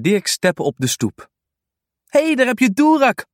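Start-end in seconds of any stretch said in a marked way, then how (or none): 2.1-3.3 hoge, luide kinderroep; roepend. Acted. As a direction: roep blij naar de hond. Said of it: "roept Kolletje". (kind roept vrolijk naar de hond)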